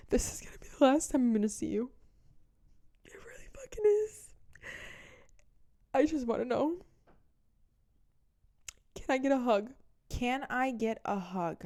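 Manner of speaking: high, whimpering beg; mock crying plea. The ex begging her for a hug, mock crying. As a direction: plead through fake tears.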